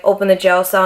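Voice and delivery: usual speaking voice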